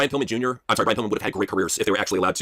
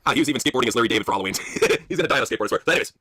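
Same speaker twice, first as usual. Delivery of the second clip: ordinary talk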